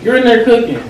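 loud, high, exasperated rant; snapping. Acted. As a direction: vent all your irritation at her. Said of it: "Why you been snapping all day?".

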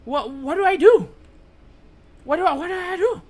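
high, loud, stammering panic; panicked. Real, not acted. Player lost mid-scare in horror game, panicked. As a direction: squeal it helplessly, tripping on the words.

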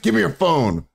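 lightly hammed-up bossy order; imitating an angry woman. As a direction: mimic her demanding, voice lifted. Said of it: "when she's like".

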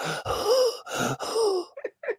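high, strained imitated gasp for breath; exaggerated out-of-breath gasp; mock gasp. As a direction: play it like wheezing after a huge meal. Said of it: "I'd be breathing like".

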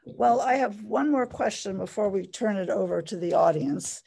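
usual speaking voice

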